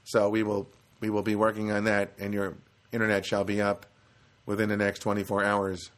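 measured, monotone service robot. Retold or imitated. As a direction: deliver it word by word like a machine. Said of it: "She really, really was quite a robot".

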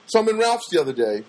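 usual speaking voice

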